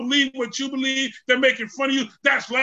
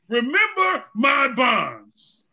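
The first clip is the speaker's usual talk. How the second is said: slow solemn emphasis; much slower than usual. Acted. Paul quoted, slowed.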